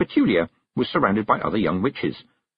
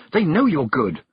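higher, encouraging voice; urging; 6 semitones higher, 3 dB louder than usual. A narrator's reading. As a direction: gently push a friend forward. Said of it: "Dimity urged".

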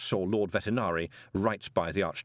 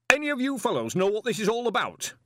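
high, louder call over a noisy room; raised voice above din; 12 semitones higher, 4 dB louder than usual. A narrator's reading. Lecturer calls to fellow wizards over the din, raised voice. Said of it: "raising his voice above the din".

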